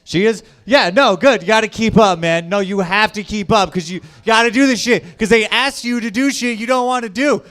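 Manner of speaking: ordinary talk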